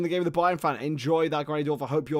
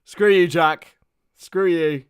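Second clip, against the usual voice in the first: louder playful jab; mock insult; 3 semitones higher, 11 dB louder, slower than usual. Streamer jokingly answering a viewer, mock insult. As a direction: tease a friend with a fake snap.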